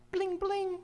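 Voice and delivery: high-pitched bell imitation; imitated bicycle bell. Bicycle cop's siren is a bell, high mock ringing. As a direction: ring it out like a tiny bike bell.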